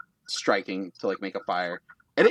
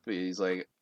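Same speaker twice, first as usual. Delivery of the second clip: normal talking voice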